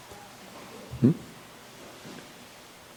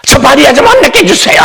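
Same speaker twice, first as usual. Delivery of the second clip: high, fast whining plea; complaining Martha; 10 semitones higher, faster than usual. Retold or imitated. Martha begging Jesus to scold Mary, high and whiny. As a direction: whine it up high and quick.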